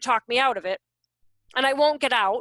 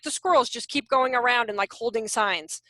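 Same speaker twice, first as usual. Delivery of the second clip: normal talking voice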